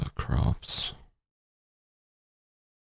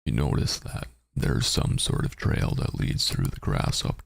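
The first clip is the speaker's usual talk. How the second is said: narrating a text adventure; 2 semitones higher than usual. Acted. Reads text adventure aloud.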